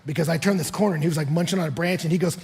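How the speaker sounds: usual speaking voice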